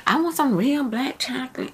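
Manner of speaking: mock whisper, hushed and high; hushed, high mock of the women's complaint; mimicking picky women. Mocking picky women who want dark chocolate, in a hushed high whisper. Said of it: "They be like".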